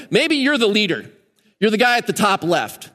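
ordinary delivery

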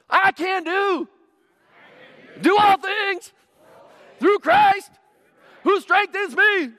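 high, loud rally call; pumped-up athlete's call-and-response; imitated gladiator athlete. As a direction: lead the crowd in a hammed-up chant. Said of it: "he talked like this".

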